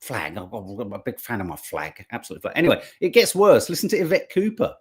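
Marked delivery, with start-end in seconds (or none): 0.0-2.5 lower, softer mock politician droning about flags; Trump-like parody. Host mocking the leader as Trump-like, lower and softer. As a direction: drone the word flag like a dull strongman. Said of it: "he's a bit like Donald Trump".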